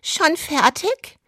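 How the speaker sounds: high and slow, teasing the workman; hammed-up dubbed housewife. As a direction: play the flirty porn dub wife.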